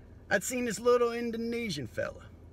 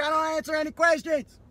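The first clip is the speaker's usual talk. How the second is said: louder, higher mock yell; 7 semitones higher, 6 dB louder than usual. Man yelling at the cop through his window. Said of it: "Yelling through his window loud like that".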